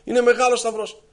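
high-pitched, overburdened wail; mock crying out. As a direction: act out begging God for relief. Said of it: "φώναζε".

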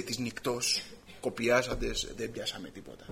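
a touch of tired fishermen's defeat; weary report. Acted. Fishermen report a fruitless night.

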